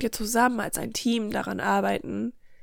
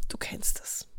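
whispered aside; 9 dB quieter than usual. Real, not acted. Vertrauliche Nebenbemerkung an den Hörer.

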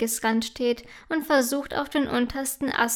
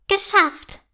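high, loud cheer; triumphant little elf's jubilation; 8 semitones higher, 6 dB louder than usual. A narrator's reading. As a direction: burst out in triumph. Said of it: "jubelt sie".

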